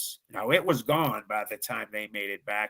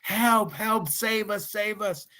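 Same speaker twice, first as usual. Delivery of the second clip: high-pitched cry for help, fading at the end; retold scream for help; 9 semitones higher, slower than usual. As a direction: call out for rescue, desperate. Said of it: "screaming at the top of our lungs".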